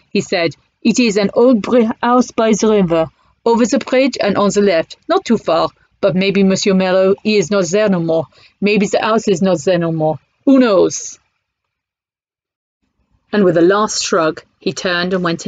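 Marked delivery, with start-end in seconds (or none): 0.8-11.2 put-on light French accent, resigned; broken French English. Cafe owner directs Bertie, light French accent. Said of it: "in broken English".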